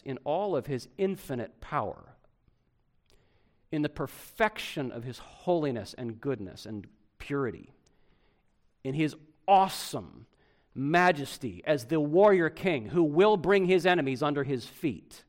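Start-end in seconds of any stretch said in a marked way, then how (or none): none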